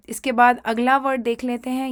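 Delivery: ordinary talk, nothing marked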